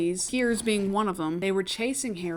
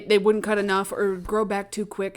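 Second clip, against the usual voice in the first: normal talking voice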